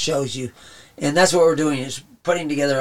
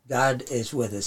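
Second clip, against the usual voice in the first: ordinary talk, nothing marked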